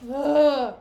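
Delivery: loud exasperated "ugh"; loud, fed-up groan; disgusted groan. Real, not acted. Disgust at Iago's rumor.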